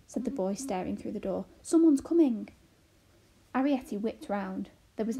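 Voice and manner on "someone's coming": raised, loud alarm; alarmed alert; urgent warning. A narrator's reading. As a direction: warn them urgently. Boy warns someone is coming.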